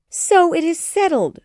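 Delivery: thrilled mother exclaiming; cried happily. A narrator's reading. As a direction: burst in triumphant. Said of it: "she cried".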